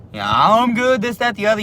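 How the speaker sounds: a light, high Middle Eastern accent, imitating the customer; hint of a thick Middle Eastern accent; mock Middle Eastern accent. Clerk imitates customer's Middle Eastern accent. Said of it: "in his real thick accent".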